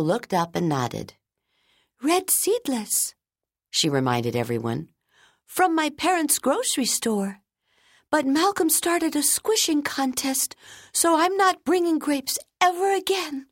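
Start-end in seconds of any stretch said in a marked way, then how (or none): 2.0-13.5 put-on a child grumbling about the grape contest; young girl's voice. Keiko's child voice.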